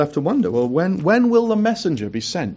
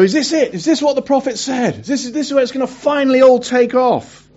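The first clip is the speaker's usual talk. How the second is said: eager questioning; 8 semitones higher, 6 dB louder than usual. Retold or imitated. People asking after the exile.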